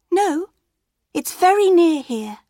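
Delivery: put-on high, loud eager child; bright little girl reply. A narrator's reading. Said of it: "girl answering wolf".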